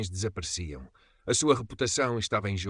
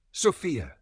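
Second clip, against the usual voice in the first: mild narrated shout; 6 semitones higher than usual. A narrator's reading. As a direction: call out the name, high and falling. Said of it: "gritou Carvalho, a voz a ecoar".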